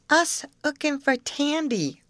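put-on high-pitched small child's baby talk; Dodo. A narrator's reading. Small twin announcing a demand for candy, high baby talk. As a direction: announce it as a little child, high and matter-of-fact. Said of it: "calmly announced Dodo".

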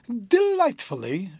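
high, slow and gushing on one word; delighted girl. A narrator's reading. Rosa answers her fiancé.

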